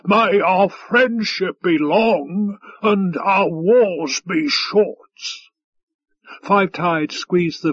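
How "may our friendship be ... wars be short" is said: very slow, drawn-out toast, pitched up; rumbled toast. A narrator's reading. Five Tide toasting his guest, rumbling. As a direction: stretch out each word of the toast grandly. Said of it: "he rumbled".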